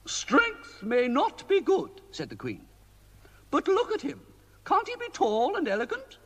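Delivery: high, fussy queenly voice; a worried queen pleading with the wizard. A narrator's reading. Queen complaining to Mister Ben about the king, high queenly voice.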